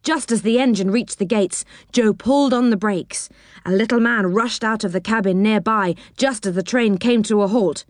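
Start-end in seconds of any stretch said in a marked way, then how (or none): none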